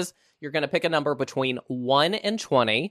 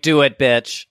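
taunting dare; 7 dB louder, slower than usual. Retold or imitated. Host voices the angry husband.

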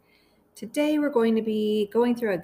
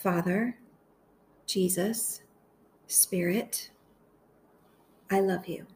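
guided meditation. Acted. Host praying phrases aloud for listeners to repeat, guided meditation. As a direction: lead them gently through it, phrase by phrase, unhurried.

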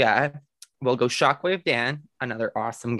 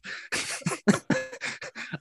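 high breathy laugh; 7 dB quieter than usual. Real, not acted. Embarrassed laugh at own answer.

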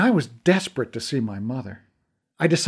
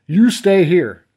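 barked command, louder; firm order; 5 semitones higher, 8 dB louder, slower than usual. Acted. Detective ordering Connor to stay, firm raised command. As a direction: order him to stay put.

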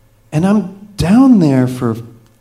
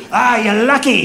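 hammed-up higher pirate accent, half laughing; 7 semitones higher, 3 dB louder than usual. Captain telling the tourist he's lucky, in a hammed-up pirate accent. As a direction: play the boat captain as a cartoon pirate. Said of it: "so you're gonna get pirate".

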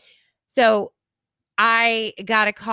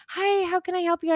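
high, sweet front-desk greeting; sweet receptionist; 10 semitones higher than usual. Retold or imitated. Young girl at the vet desk greeting a customer, sweet and high. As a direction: greet like a kindly receptionist, voice lifted. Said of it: "She was so sweet".

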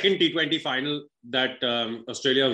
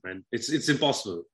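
ordinary delivery

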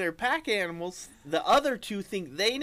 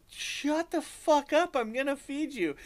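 exasperated owner; 7 semitones higher than usual. Retold or imitated. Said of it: "you're like".

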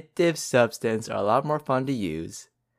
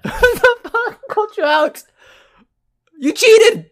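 loud, high-pitched mock outrage; indignant high yell; outraged accusation; 8 dB louder, slower than usual. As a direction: shout the accusation at the cheater. Host accuses player of cheating.

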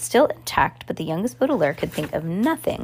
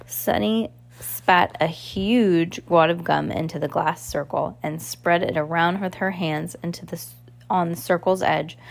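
usual speaking voice

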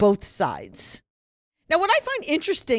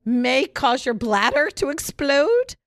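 mock warning; 3 semitones higher, slower than usual. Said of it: "the trigger warning".

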